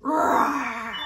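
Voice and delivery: mock lion roar, not scary. Shown as a demonstration. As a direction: roar like a friendly lion for toddlers. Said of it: "What sound does a lion make".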